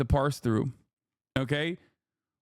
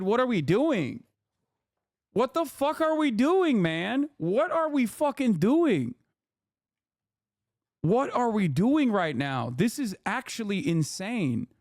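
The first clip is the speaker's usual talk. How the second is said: rising exasperated cry at the absurdity; incredulous outrage; 5 semitones higher, slower than usual. Real, not acted. Outraged at racist billboard.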